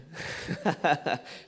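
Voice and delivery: real chuckling laugh; amused laugh. Real, not acted. Amused by young people's joke.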